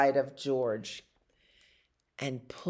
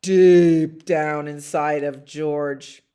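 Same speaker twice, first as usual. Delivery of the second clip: much louder and slow, stretching out deep; 3 semitones lower, 9 dB louder than usual. A narrator's reading. Reading to children, building to the deepest reach. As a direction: land deep down with weight. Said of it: "reached deep".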